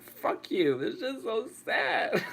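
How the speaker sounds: high-pitched mock bawling protest; imitating tearful child. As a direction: act out a tearful kid talking back. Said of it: "fucking bawling my eyes out".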